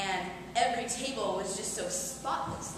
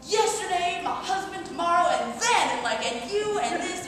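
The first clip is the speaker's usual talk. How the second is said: lightly voiced babbling, higher and choppy; jumbled word fragments tossed out, a bit higher; babbling stranger; 8 semitones higher, 5 dB louder than usual. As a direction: splutter disconnected fragments like a confused stranger. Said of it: "spluttering these random sentence fragments".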